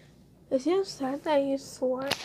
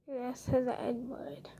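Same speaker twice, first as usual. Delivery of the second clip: whisper; 2 semitones lower, 12 dB quieter than usual. Real, not acted. Confessing a slur in game.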